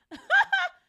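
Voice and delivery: high sudden cackle; loud burst of laughter. Real, not acted. Speaker laughing at her own UFO quip, loud. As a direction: explode into a laugh at the joke.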